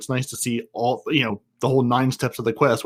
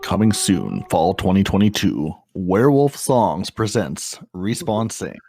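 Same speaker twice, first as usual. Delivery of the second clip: trailer announcer; 4 semitones lower, slower than usual. Pretend. Mock movie trailer announcement.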